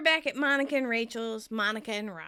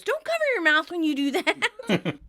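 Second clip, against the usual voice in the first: high, loud imitated order; mock yell; 7 semitones higher, 8 dB louder than usual. Phoebe yells at sneezing Monica, loud and hammed-up. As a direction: yell it out in pretend outrage. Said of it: "and Phoebe yells".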